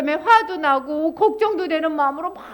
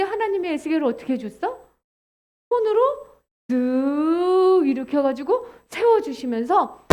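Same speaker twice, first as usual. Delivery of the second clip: ordinary talk, nothing marked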